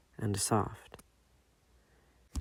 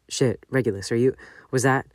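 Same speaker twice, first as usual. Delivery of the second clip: suddenly louder, worried and urgent; alarmed; 7 dB louder than usual. Acted. James startled by Regulus crying, louder and alarmed. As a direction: blurt it out in alarm.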